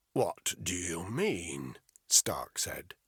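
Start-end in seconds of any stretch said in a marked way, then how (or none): 0.1-1.8 catch in voice. A narrator's reading. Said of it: "a catch in his voice".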